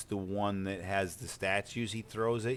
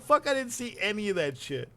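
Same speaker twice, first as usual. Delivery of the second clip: mock exasperation; 12 semitones higher than usual. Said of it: "And I'm like".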